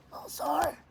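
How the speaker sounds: squeaked mock understatement; playful high voice; high-pitched repeat. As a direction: jump up into a high little voice.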